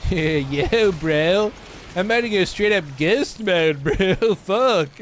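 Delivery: hammed-up Californian drawl with a laugh; beyond-California accent. Streamer jokingly riffing on ghost mode, exaggerated California accent. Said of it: "that's my true accent. That's, uh, that's beyond California".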